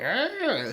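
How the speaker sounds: high mock screech; imitated high witch squawk; lip pulled to the side. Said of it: "talks like this with her lip pulled to the side".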